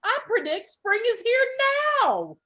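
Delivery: high, loud proclamation; shouting. A narrator's reading. Groundhog proclaiming spring, shouting. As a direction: announce it to everyone. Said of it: "kept shouting".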